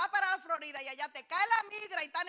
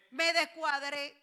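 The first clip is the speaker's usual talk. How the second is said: mock complaint; 8 dB louder than usual. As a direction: act out a churchgoer moaning. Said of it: "y se queja".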